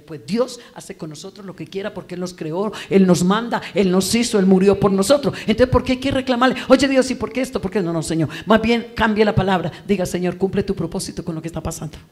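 loud, high, fervent sermon voice; preaching at full force. Acted. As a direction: preach it out to the whole church. Predica a toda voz. (predicación a toda voz, fervorosa)

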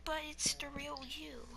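hushed, whispery mock movie line; cringy movie moment. Mocking a cringy movie moment, in a hushed voice. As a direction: whisper it like a sappy film scene. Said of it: "cringy moment where it's like in movies".

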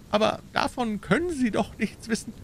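hohe, ungläubige Stimme; nervöses Männlein. A narrator's reading. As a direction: dem Fremden verunsichert widersprechen. Nervous little man doubts Holmes. (ängstlicher kleiner Mann)

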